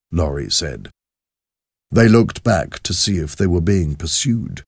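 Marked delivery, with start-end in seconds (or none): none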